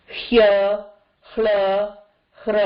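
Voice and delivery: ஹ்ய, ஹ்ல, ஹ்ர each led by an audible h; h sounded first. Shown as a demonstration. Teacher shows the rule, h first in ஹ்ய, ஹ்ல, ஹ்ர. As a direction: say ஹ்ய, ஹ்ல, ஹ்ர as hya, hla, hra, separately. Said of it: "everything you can hear the ஹ first".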